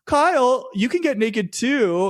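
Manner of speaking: high, loud call on the name, then slow teasing; teasing joke. Retold or imitated. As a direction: needle him playfully, raising the voice. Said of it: "making an innocent joke".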